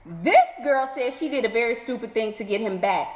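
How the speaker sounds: ordinary delivery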